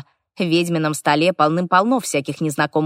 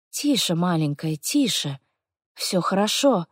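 soft, hushed comforting; calm the crying child softly; soothing; 5 dB quieter than usual. A narrator's reading.

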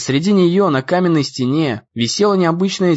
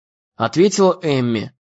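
ordinary delivery, nothing marked

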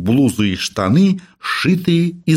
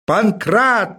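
repeated shout; 9 semitones higher than usual. Acted. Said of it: "повторил профессор".

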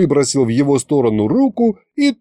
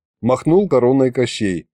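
ordinary delivery, nothing marked

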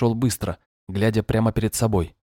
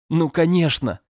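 a realization bursting out high; sudden exclamation; 8 semitones higher, 3 dB louder than usual. Acted. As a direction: shout it as it clicks. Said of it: "воскликнул он".

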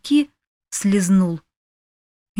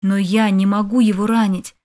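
ordinary delivery

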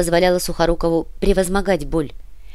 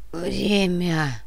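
groaning; 3 dB quieter than usual. A narrator's reading. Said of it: "простонал Сантьяго".

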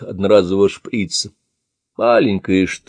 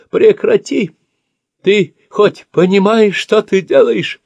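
panicked pleading; 13 semitones higher, 5 dB louder, slower than usual. Acted. Old man pleading under assault.

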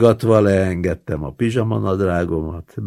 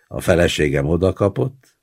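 usual speaking voice

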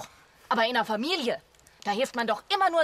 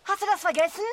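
indignant reminder; 8 semitones higher than usual. Acted. Indignant reminder about the watch.

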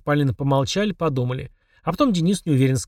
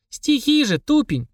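mocking girl; 12 semitones higher, slower than usual. A narrator's reading. Polina mocks brother.

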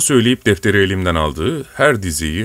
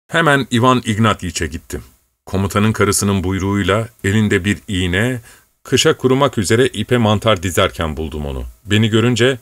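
ordinary delivery

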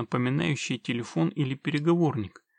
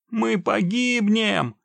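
high, loud, drawn-out wail of doom; panicked yelling; 8 semitones higher, 6 dB louder, much slower than usual. A narrator's reading. Trapped stranger begs the witcher, panicked yelling. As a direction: yell it slowly in despair. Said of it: "продолжал орать незнакомец".

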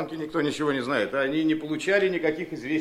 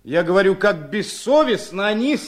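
louder, slower repeat for a father who didn't hear; 4 semitones higher, 6 dB louder, slower than usual. Acted. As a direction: say it again slow and clear. Son repeats for deaf father.

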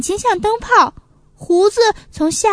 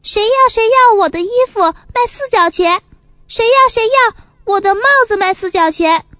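loud, higher hawking call; 6 semitones higher, 5 dB louder than usual. Acted. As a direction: cry out to sell to the crowd. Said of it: "一边喊着".

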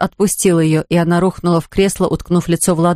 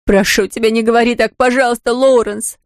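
desperate pleading; 4 semitones higher than usual. Acted. Sister begging her brother.

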